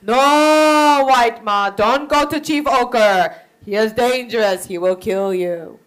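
loud, insistent chief; urgent warning. Acted. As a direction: plead with her not to go. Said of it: "But Chief Idem said".

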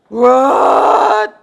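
long, loud bellow of disbelief; outraged shout. Acted. Father told she will stay with Amy, outraged shout. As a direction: roar it as a furious father.

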